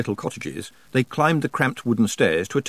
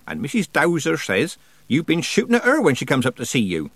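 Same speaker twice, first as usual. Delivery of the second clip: put-on mildly stern, higher old witch voice; severe scolding; 6 semitones higher than usual. A narrator's reading. Nanny scolds bedridden old man, mildly severe. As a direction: add another charge to the telling-off. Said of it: "said Nanny severely".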